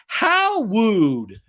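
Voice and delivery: lightly voiced sitcom "How rude"; Full House catchphrase. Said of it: "remember in Full House?".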